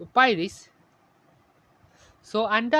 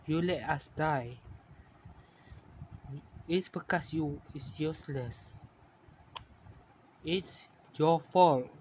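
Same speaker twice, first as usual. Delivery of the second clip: low, soft, slowing reproach; hushed, haunting blame; quiet accusation; 4 semitones lower, 6 dB quieter than usual. A narrator's reading. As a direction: lay the blame softly, with long pauses. Ghostly sister blames the dreamer.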